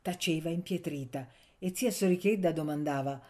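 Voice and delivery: usual speaking voice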